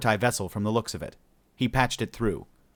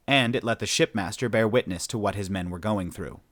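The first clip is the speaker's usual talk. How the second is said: ordinary delivery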